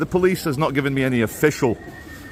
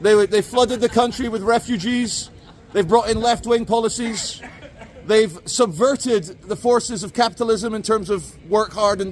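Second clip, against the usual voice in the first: higher, pompous mock reactionary voice; mock sexist ranter; 7 semitones higher than usual. Vlogger mocking a sexist's view of women voting, higher pompous voice. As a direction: lampoon a smug anti-suffrage crank. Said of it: "And, uh, she says".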